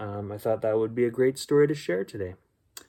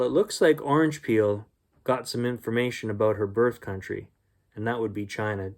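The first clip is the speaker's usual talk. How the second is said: ordinary delivery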